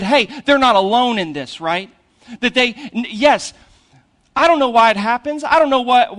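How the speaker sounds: usual speaking voice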